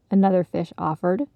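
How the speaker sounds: plain reading voice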